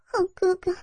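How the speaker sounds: put-on high, coquettish girlish whine, fading to whisper; nasal whine. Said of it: "嗲声嗲气地说道". (嗲声嗲气的撒娇)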